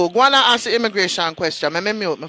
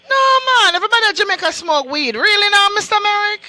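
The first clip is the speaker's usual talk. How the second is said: high-pitched, disbelieving Jamaican objection; real indignant disbelief, pitched up; incredulous protest; 9 semitones higher than usual. Real, not acted. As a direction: protest as if it's absurd. Host protests ban on ganja.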